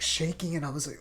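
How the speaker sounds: usual speaking voice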